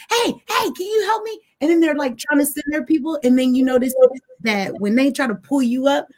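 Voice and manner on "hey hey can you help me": pleading for help. Retold or imitated. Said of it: "And then you're like".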